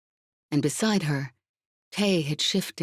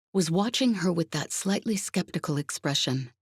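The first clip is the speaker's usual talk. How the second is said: usual speaking voice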